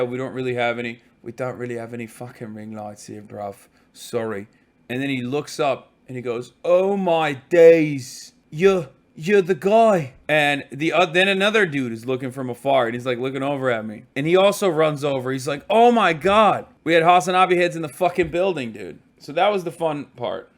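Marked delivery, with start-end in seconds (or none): 1.2-4.5 low, soft British lad voice; mock British accent, low and subdued; imitated British accent. British shop worker imitated after restart. 6.7-10.1 put-on drawn-out, louder British accent; mock British astonishment, slow and loud; amazed British lad impression. British worker recognises him, astonished. 15.7-16.7 high, loud mock amazement; excited outburst, acted out; imitated starstruck excitement. Second worker runs over, excited.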